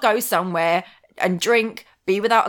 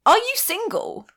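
higher, slow, pointed challenge; pointed question; 10 semitones higher than usual. Retold or imitated. To her date, a slow pointed challenge. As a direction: ask it slowly, daring him. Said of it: "I'm gonna ask you this just once".

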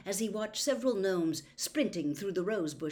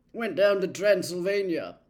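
gloomy; 9 dB louder than usual. A narrator's reading. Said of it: "said Charlie gloomily".

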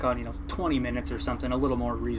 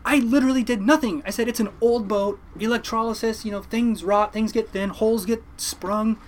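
exasperated explaining; 7 semitones higher than usual. Retold or imitated. Arguing with mom on phone.

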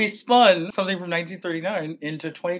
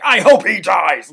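mock online outburst; 3 semitones higher, 12 dB louder, slower than usual. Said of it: "people on social media like".

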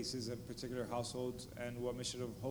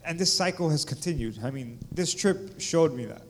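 loud, raised and urgent, near preaching; impassioned testimony; 2 semitones higher, 11 dB louder than usual. Real, not acted. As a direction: pour it out to the crowd with full voice.

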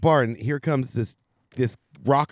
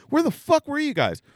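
much higher, exasperated demand; where were you, voice shooting up; retelling his anger at the bar crew; indignant outrage; 14 semitones higher than usual. Retold or imitated. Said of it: "I'm like".

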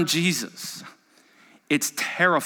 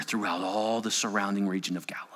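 whisper; 7 semitones lower, 7 dB quieter, faster than usual. Acted.

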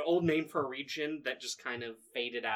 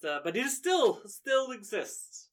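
a hint of a higher, cheerful villager; lightly voiced Ericles; 6 semitones higher, 4 dB louder than usual. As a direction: play the friendly host, a little higher.

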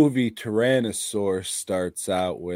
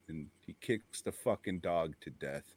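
normal talking voice

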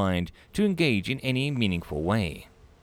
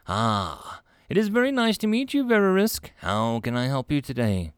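bright high welcoming voice, clearly higher; a cheerful human clerk, pitched up; friendly receptionist Melanie; 4 semitones higher than usual. Acted. Clerk greets a nervous alien visitor, high and friendly.

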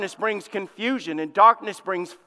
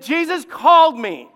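louder, higher, slowed proclamation; emphatic quote; 7 semitones higher, 7 dB louder than usual. Acted. Preacher booms Paul's words to the congregation, loud and slow.

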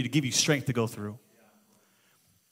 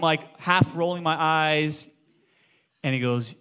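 ordinary delivery

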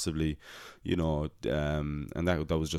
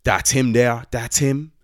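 loud, high accusing cry of the angry mother; mother spotting him and shouting him out, loud and high; 6 semitones higher, 8 dB louder, slower than usual. Retold or imitated. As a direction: point him out loudly and high. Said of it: "I hear this voice go".

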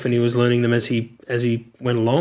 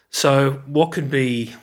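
ordinary delivery

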